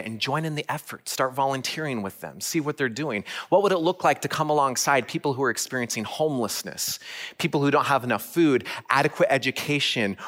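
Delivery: rally the congregation to act; preaching appeal. Acted. Rising preaching call to action.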